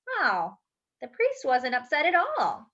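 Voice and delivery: plain reading voice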